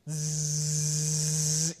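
imitated Polaroid print buzz, soft and low; camera whirr. Pastor mimicking the Polaroid printing, soft buzz. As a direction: buzz like the camera spitting out a photo.

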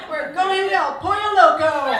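loud, laughing declaration of "El Pollo Loco"; defiant and laughing. Real, not acted. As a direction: announce the treat through laughter. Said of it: "He's not taking my joy today".

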